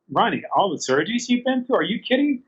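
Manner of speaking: retold disbelief, pitch climbing high to the end; incredulous protest. Telling friend he has been through all the surgeries, incredulous.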